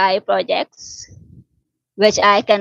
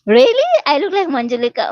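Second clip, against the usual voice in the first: delighted surprise; 6 semitones higher than usual. Real, not acted. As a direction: squeal it, thrilled by the compliment. Delighted reply to a compliment.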